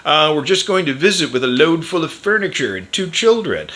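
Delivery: dad's excuse. Retold or imitated. Dad answering the border guard with a load of furniture, mock-innocent. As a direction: play up a flimsy cover story. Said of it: "the guy at the Canadian border wouldn't let us in".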